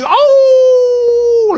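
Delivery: long, high, loud howl; aullido. Acted. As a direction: howl like a tormented soul. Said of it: "aullidos, dice". (aullido largo y agudo)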